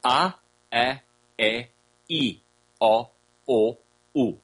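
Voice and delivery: A, a, e, i, o, u one at a time, as pure ah to oo sounds; slow pure vowels. Shown as a demonstration. Teacher redoing it for the recording, A, a, e, i, o, u slowly as pure vowels. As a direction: space out A, a, e, i, o, u, each held without a glide. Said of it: "let me do it slow".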